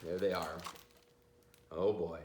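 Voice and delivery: ordinary delivery, nothing marked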